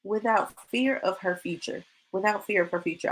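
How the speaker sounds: hushed and wondering, turning the words over; musing softly. Retold or imitated. Quoting the verse she meditated on, soft and musing. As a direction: repeat the phrase softly to yourself, like meditating. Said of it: "And I was like".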